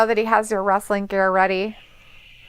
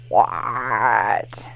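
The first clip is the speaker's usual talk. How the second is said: whisper; 12 semitones lower than usual. Real, not acted. Whispered reaction.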